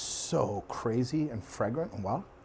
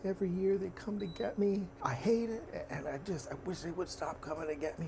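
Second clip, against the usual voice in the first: crying burly man; 8 semitones higher, 3 dB quieter than usual. Retold or imitated. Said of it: "He starts crying".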